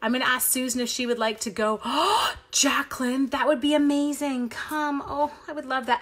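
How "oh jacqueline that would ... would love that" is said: higher and louder, thrilled; light up at happy news; delighted gasp. Real, not acted. Crafter thrilled a viewer might come, delighted.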